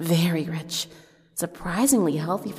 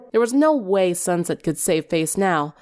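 usual speaking voice

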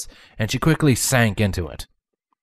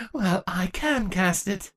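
proud; 10 semitones higher, 4 dB quieter, slower than usual. Acted. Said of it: "stated with pride".

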